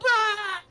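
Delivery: imitated panicked scream; mock coworkers' scream. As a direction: yelp like everyone who saw the bug. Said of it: "everybody was like".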